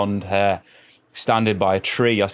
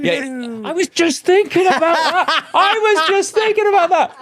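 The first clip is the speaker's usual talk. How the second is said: excited squeal; 5 dB louder than usual. Real, not acted. Excited, laughing about the solo.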